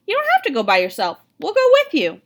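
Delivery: chewing while talking; mouth full. A narrator's reading. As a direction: talk round a mouthful. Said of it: "with a mouthful of sandwich".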